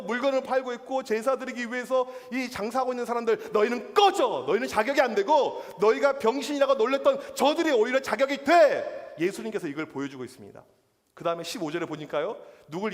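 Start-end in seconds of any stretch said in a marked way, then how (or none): 3.5-9.1 loud, raised-pitch scolding, acted out; angry rebuke. Preacher as Jesus casting out temple merchants, angry rebuke. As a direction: drive them out with a forceful shout.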